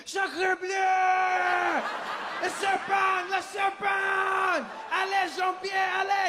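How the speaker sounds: high, drawn-out French yelling; panicked Frenchman. Acted. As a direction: scream the alarm as a fleeing French dad. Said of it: "The French guy was my favorite 'cause he jumps up".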